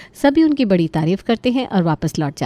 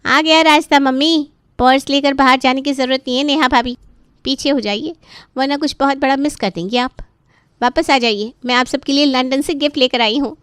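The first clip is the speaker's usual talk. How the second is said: put-on cheerful, teasing young woman, a bit higher; bubbly daughter arriving; 6 semitones higher than usual. Daughter Kiran bursts in with gifts from London, cheery and teasing. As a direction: burst in bright and playful.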